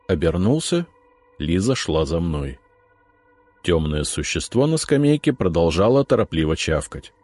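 plain reading voice

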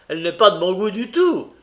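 acted irate reply; angry retort. A narrator's reading. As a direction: hit back angrily, voice climbing. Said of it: "répliqua le juif avec colère".

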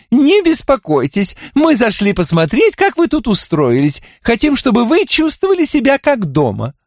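high, prim and ceremonious. Acted. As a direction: decline with polite formality. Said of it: "церемонно сказала Энни".

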